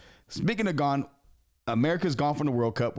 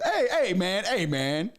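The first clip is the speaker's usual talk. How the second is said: imitating Big Ant; 10 semitones higher than usual. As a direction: play the excited friend calling in. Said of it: "and goes".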